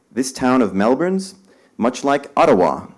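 mild character voice inside the poem; lightly voiced traveller. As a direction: lift the quote a little above the verse.

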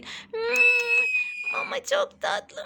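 very high squeal of delight; doll voice. Acted. Mother voicing the hungry doll enjoying food, high squeal. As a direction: squeal like a toy baby tasting food.

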